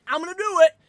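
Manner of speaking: loud, high, defiant declaration; defiant shout. Shown as a demonstration. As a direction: shout it out, refusing to hold back. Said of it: "can I bitch for a second".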